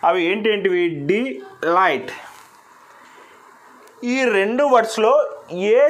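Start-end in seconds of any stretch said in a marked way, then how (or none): 1.1-2.1 very slow, split into two syllables with a gap. Shown as a demonstration. Teacher splitting the word for students, slowly. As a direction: draw out de and light with a clear break. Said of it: "ఒకసారి gap ఇస్తున్నాము".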